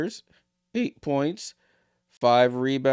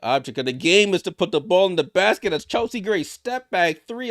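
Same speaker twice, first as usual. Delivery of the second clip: rising, louder live basketball call; play-by-play call; 8 semitones higher, 5 dB louder than usual. Acted. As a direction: call the drive and finish as it happens.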